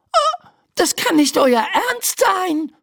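high, exasperated outcry; crying out. A narrator's reading. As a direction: cry out in high-pitched disbelief. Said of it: "schreit Laura". (hoher, entrüsteter Aufschrei)

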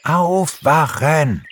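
high, drawn-out call; wake-up call. A narrator's reading. Pixie and friends rouse the bear, calling out. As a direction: call out slowly to rouse a sleeper. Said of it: "riefen Pixie und seine Freunde".

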